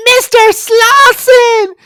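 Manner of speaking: very high, loud, slow call; imitated scream. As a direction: belt out the name like a panicked heroine. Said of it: "She's, like, screaming".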